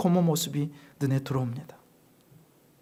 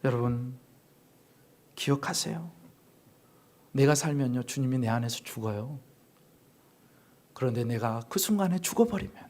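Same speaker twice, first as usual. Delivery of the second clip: 낮고 조용하게 당부하는 설교; 목소리를 낮춰 간곡히 당부; 나직한 설교; 6 semitones lower, 5 dB quieter than usual. Real, not acted. (낮고 차분하게 권면)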